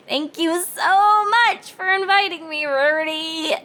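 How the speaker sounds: high, tight, through clenched teeth; forced polite thanks, teeth clenched; Twilight voice. A narrator's reading.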